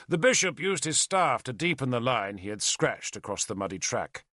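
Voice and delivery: ordinary delivery, nothing marked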